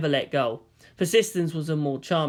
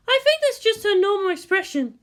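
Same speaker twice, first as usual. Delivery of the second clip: calm woman; 3 dB louder than usual. Acted. Woman character line.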